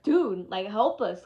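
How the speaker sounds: loud, desperate plea; pleading for help. Retold or imitated. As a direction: call out to the doctors to help, loudly. Begging the doctors for help.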